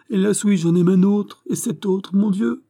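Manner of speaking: high, loud tearful confession; anguished cry. A narrator's reading. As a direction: cry it out in despair. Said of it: "s'écria le pauvre enfant". (cri de désespoir, voix aiguë)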